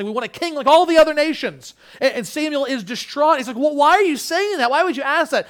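high, loud retelling with acted lines; forceful dramatic preaching. Preacher retelling Samuel and God on kingship, forceful preaching. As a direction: act out the dialogue from the pulpit, loudly.

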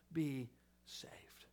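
"be saved" breathed out in a whisper; whispered ending. Acted. Preacher closing Spurgeon's quote with whispered awe. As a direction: let the last words fall to a whisper. Quoting Spurgeon's verse, falling away.

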